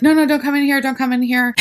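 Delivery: high, loud frantic plea to stay away; mock-panicked no no no; panicked warning. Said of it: "Sydney's like".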